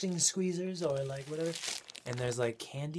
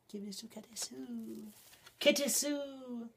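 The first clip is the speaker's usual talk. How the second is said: cutesy high voice; 10 semitones higher, 3 dB quieter, slower than usual. Acted. Reads card in cutesy voice.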